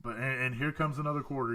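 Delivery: ordinary talk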